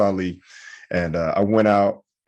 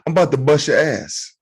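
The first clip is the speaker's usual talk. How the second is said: higher-pitched bluffing threat; mock tough guy; 6 semitones higher than usual. Cut-off driver threatening the other man, mock tough. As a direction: threaten him with comic bravado. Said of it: "trying to be a tough guy".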